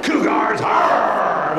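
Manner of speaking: shouted cheer ending in a growl; cougar growl cheer. Shown as a demonstration. Principal showing the school's cougar growl, shouted. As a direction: roar it like a school mascot. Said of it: "We do cougar growls".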